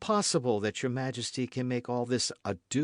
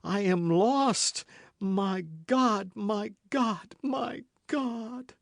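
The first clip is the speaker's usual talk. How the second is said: rising from higher to anguished cry; despairing queen; 7 semitones higher, 3 dB louder than usual. A narrator's reading. Queen alone in despair, rising to a cry. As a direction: build to an outcry to God.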